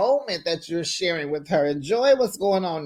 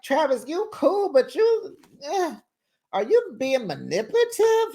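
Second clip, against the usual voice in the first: mock high questioning; 10 semitones higher than usual. Imagined question put to Travis.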